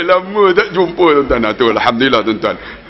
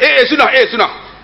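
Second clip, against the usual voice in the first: mock protest; 10 semitones higher than usual. Retold retort in a quarrel.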